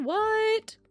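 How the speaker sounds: usual speaking voice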